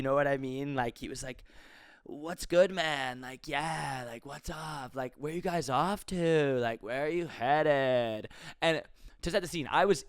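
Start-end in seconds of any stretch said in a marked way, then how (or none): 2.0-8.3 slow, drawn-out stoner driver; super high guy. Retold or imitated. Uber driver asking passengers where they're headed, super high drawl. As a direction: stretch the words like a super chill guy. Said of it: "one of those guys who sounded super high".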